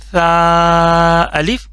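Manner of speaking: سا said light and soft, no heavy sin sound, drawn out slowly. Shown as a demonstration. Teacher showing students a soft light سا, slow. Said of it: "soft کر کے پڑھنا ہے".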